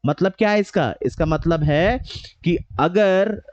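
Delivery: ordinary delivery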